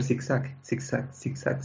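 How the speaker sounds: slow, breathy whispered zigzag; whispered, dragged-out zigzag; drawn-out whisper. Retold or imitated. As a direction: stretch zigzag, zigzag out slowly under your breath. Imitating the bus swerving.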